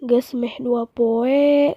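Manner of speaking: ordinary delivery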